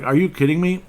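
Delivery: high, loud mock disbelief; incredulous reaction. Quotes own incredulous reaction.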